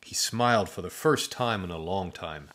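ordinary delivery, nothing marked

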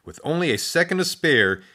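ordinary delivery, nothing marked

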